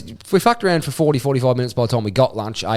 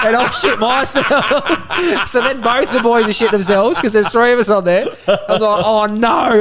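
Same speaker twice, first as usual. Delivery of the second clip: higher, laughing through the words; laughing while telling; 7 semitones higher than usual. Real, not acted. Telling a mate about the water slide, laughing through it. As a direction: tell it while you can't stop laughing.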